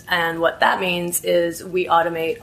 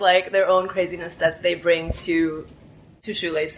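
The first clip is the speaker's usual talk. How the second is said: normal talking voice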